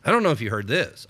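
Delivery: usual speaking voice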